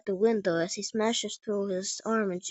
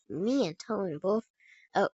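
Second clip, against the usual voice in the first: ordinary talk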